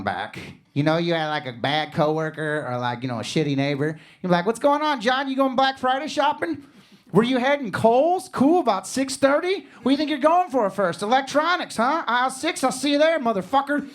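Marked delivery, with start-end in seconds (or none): 4.4-13.9 sweeten each line, then let the threat show; fake-friendly menace.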